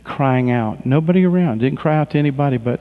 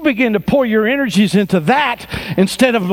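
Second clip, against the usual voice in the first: ordinary talk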